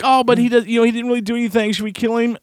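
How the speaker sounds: a hint of a child's high voice; Icho. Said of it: "But then he's like".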